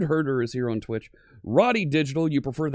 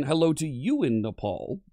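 normal talking voice

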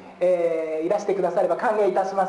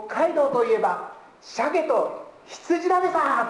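slow, higher Hokkaido dialect line, ending drawn out on だべさ; 6 semitones higher, slower than usual. Shown as a demonstration. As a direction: say it like a Hokkaido local, leaning into だべさ. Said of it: "あの、北海道弁だと、". (ゆっくり高めの北海道弁で、「だべさ」を強調して)

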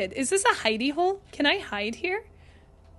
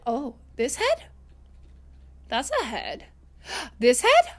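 usual speaking voice

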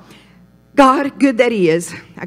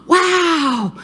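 high, drawn-out wow after a gasp; gasp, then marvel at the sudden clear view; awestruck, high-pitched exclamation; amazed gasp; 9 semitones higher than usual. Acted. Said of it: "somebody goes, just twist it and go".

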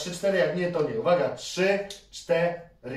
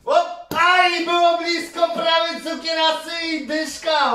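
near-miss cry; 10 semitones higher, 4 dB louder than usual. Real, not acted. Shot just misses the prize. (głośny, wysoki okrzyk „prawie”)

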